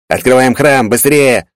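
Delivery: loud urgent yell. A narrator's reading. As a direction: yell it out in a hurry. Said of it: "завопил Фартхарас".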